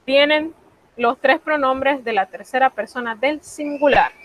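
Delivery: ordinary talk, nothing marked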